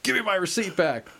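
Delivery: mock-insistent drunk demand; a hint of a drunk demanding his receipt; drunk friend demanding. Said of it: "this is what he said".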